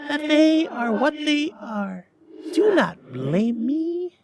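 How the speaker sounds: very high, slow, eerie spirit voice; solemn ghost. Acted. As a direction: drag the words out like a haunting spirit. Ghost speaks, narrator voices it.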